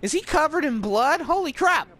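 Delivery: high-pitched panicked reaction; freaking out. Retold or imitated. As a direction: act out shocked bystanders. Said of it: "this is freaking out".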